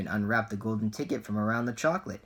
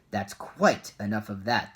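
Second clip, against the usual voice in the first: plain reading voice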